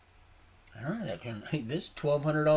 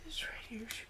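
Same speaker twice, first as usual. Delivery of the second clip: hushed, unvoiced whisper, pitched up; 8 semitones higher, 9 dB quieter than usual. Real, not acted. Man showing viewers what she left in the book, whispering. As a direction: murmur it as if sharing a discovery.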